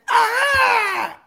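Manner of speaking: excited shout. Real, not acted. Hype shout for guest.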